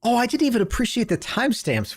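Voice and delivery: high, rapid-fire interruption; startled realisation. Real, not acted. Startled reaction to the clip.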